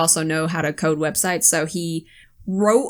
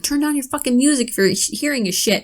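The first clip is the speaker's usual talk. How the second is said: higher-pitched retold scolding; mock scolding; 6 semitones higher than usual. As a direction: nag him to turn it down. Retold scolding about music.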